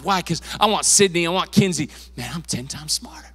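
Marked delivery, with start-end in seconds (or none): 2.2-3.3 unvoiced whisper of confidence; whispered self-talk. Pastor voicing his daughter's private confidence, whispered. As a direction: whisper it to yourself like a secret.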